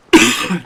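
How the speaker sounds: harsh hacking cough; loud dry cough. Real, not acted. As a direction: cough hard from a dry throat. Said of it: "Dry throat".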